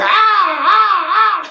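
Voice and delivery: high, loud shrieking cries through laughter; eagle-like wail. Retold or imitated. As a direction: screech like a hurt eagle. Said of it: "Sound like an eagle".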